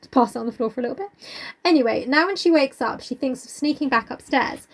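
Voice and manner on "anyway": ordinary delivery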